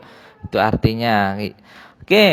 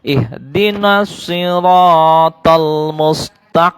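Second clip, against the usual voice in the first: Quran recitation; 4 dB louder than usual. Shown as a demonstration. Teacher chants the verse for pupils to repeat. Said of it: "So itu ya".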